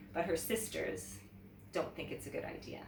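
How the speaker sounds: plain reading voice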